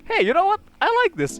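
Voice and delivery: high-pitched voicing of a sudden realisation; high, light quote of his past self; acted-out discovery. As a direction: lift the voice way up, pleasantly struck. Acting out his moment of discovery.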